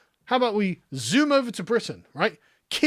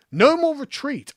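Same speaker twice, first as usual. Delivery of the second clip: emphatic; 8 semitones higher, 5 dB louder, slower than usual. Real, not acted. Rallying declaration.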